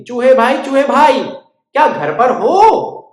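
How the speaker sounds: high, loud bird calling. Acted. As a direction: call to the mouse at his door. Bird calling at mouse door.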